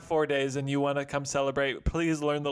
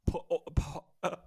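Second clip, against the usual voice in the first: choking on food mid-word; mouth full; 4 dB quieter, faster than usual. Real, not acted. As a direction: talk through a mouthful of pork. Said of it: "I choked on it a little bit".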